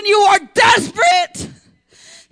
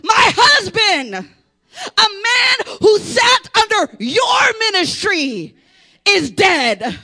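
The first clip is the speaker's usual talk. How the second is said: a widow crying out to the prophet; lightly acted grieving widow; 5 semitones higher than usual. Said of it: "She said".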